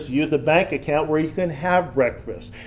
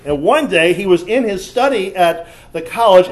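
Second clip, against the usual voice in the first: usual speaking voice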